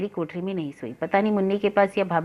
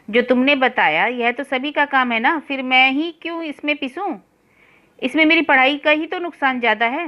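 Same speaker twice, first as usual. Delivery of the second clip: raised, pressed complaint with a frown; frowning retort; 6 semitones higher, 4 dB louder than usual. A narrator's reading. Young man protests to his sister-in-law, mildly aggrieved. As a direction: push back at the chore, a touch aggrieved. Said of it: "भौहें चढ़ाकर मैं बोला".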